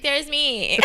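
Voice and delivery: high, giggly cry of recognition; excited exclamation. Acted. Telling the Uber driver that's her on the billboard, excited. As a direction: spot yourself and squeal it out.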